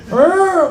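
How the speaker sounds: high, loud wailing siren noise; mock siren wail; imitated police siren. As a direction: voice a siren rising high, then falling.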